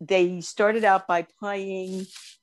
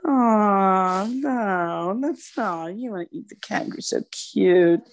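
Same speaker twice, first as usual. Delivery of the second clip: long drawn-out, cooing protest; real dismay, melting over a cute animal; pitying dismay; slower than usual. Real, not acted. Protesting that people eat kangaroo, pitying dismay.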